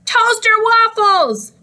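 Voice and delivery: high, slow yelled answer; yelling. A narrator's reading. Dad yelling his breakfast order over the noise. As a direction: bellow it across the room. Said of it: "Dad yelled louder".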